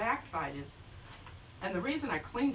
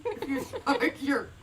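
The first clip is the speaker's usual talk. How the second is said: laughing; 4 semitones higher, slower than usual. Real, not acted. Mimicking partner's line with laugh.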